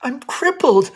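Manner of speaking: a scared little confession; frightened apology. Acted. Daughter admitting her defect, frightened and apologetic. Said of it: "in a tone of frightened apology".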